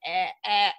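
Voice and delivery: "eh, eh" as exaggerated short vowels; imitated Midwestern vowel. Mother mimicking how daughter might read, exaggerated Midwestern "eh, eh". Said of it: "otherwise she'd be like".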